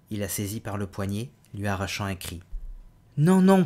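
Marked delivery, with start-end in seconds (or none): 3.2-3.6 a high, loud frightened protest; protesting cry. Acted. Woman seized by the wrist cries out in protest, loud and high. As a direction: cry out no as he grabs you. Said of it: "protesta-t-elle".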